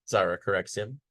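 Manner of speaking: ordinary delivery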